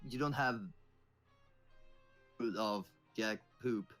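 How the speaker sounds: usual speaking voice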